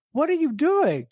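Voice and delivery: high what are you doing; incredulous. Retold or imitated. Co-host recalling the fourth-wall break, incredulous. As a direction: jump up in disbelief.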